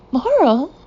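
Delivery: high startled gasp of a name; shocked disbelief, loud and high. Acted. Shocked at mailed letter.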